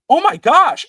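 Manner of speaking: high, loud mock gasp of shock; imitating daughter's reaction. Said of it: "I listened to her reaction".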